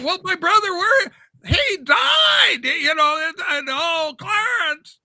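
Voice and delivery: high, loud hammed-up stammering breakdown; Jimmy Stewart impression. Host acting out George Bailey falling apart. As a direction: stumble through it in frantic grief. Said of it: "he goes through anger, happiness, sorrow".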